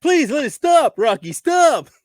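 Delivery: high goofy cartoon moose voice; Bullwinkle voice. Bullwinkle impression.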